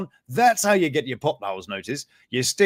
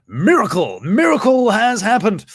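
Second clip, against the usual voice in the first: mock proclamation; 5 semitones higher, 8 dB louder than usual. Announcing the miracle mockingly.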